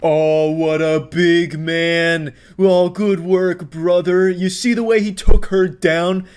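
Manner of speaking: slow, drawn-out sarcastic praise; mock admiration. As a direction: lay the fake awe on thick.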